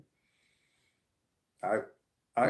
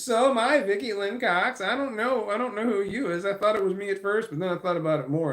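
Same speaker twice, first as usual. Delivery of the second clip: excited; 9 semitones higher, 6 dB louder than usual. Real, not acted. Excited reply to commenter.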